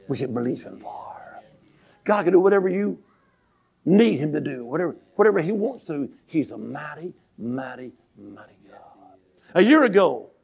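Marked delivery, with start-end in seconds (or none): 0.1-1.2 sudden whisper. Real, not acted. Drops to a whisper. 6.3-9.2 softer with each mighty, ending near a whisper; fading to a hush. Real, not acted. Preacher hushing the church on mighty God, fading whisper. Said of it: "fading repetition".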